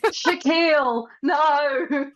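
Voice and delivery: high, loud pleading in pretend terror; mock nightmare cry. Playing along as the dreamer, mock panicked wail. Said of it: "you're just having a nightmare going".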